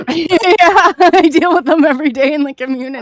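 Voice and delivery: amused laughing reply. Real, not acted. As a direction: chuckle out the joke. Laughing joke about snakes.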